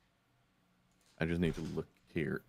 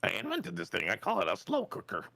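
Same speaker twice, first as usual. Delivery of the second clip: proud inventor; 10 semitones higher than usual. Acted. Jokey inventor voice.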